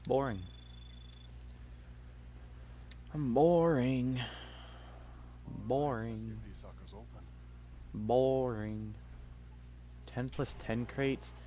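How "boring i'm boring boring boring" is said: slow, low-key drawn-out "boring"; drawn-out grumble. Real, not acted. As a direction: idly stretch it out, softly. Idle drawn-out muttering into the mic.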